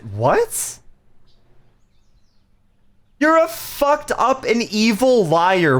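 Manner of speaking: ordinary talk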